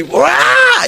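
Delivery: very high imitated yell; high-pitched mock scream; wild cry. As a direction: let out a leaping whoop. Acting out his leap and yell.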